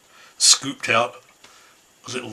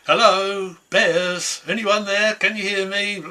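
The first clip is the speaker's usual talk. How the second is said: shouting; 8 semitones higher, slower than usual. Acted. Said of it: "she started shouting".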